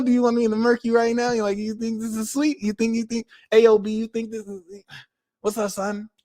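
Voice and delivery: high-pitched mock New York tough guy; bad New York accent. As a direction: threaten in a badly faked New York accent. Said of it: "I wish I could do the New York accent".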